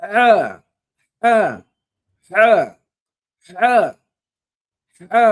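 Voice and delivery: হা pushed loudly from the throat; breath out হা from inside; throaty ha. Shown as a demonstration. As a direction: model হা drill. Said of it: "ভিতর থেকে বাতাস ছেড়ে আসতে হবে".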